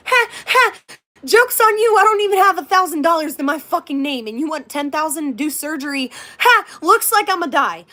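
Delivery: retold comeback, hammed-up and punchy; mock defiant retort. Patient retorts to the ten thousand dollar surgery bill, mock defiant.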